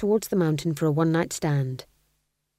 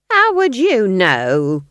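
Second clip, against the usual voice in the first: loud, slow, indignant snap; snapping; 2 semitones higher, 9 dB louder, much slower than usual. A narrator's reading. Nanny snaps back at Granny's accusation. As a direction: throw it back loudly, stretching the words. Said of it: "snapped Nanny".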